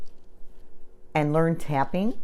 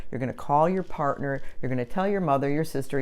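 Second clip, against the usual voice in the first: ordinary delivery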